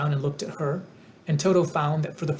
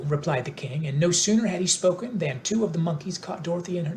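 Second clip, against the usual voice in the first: ordinary delivery, nothing marked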